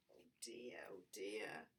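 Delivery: whispering. A narrator's reading. Worried whisper.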